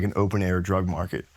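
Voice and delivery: usual speaking voice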